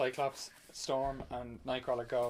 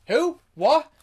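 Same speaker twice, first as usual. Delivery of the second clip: sudden high, loud burst of disbelief; shocked outburst; 8 semitones higher, 11 dB louder, slower than usual. Retold or imitated. Retold hero hears his friend was killed, shocked loud outburst. Hank tells him Mystique died.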